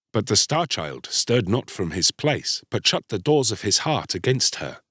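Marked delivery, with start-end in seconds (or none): none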